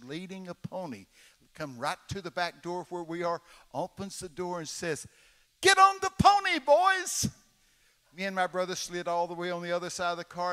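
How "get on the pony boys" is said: put-on high, loud coaxing call to the boys; orphanage lady. Lady at the car door coaxing the boys, high and loud. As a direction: sweetly lure the boys out.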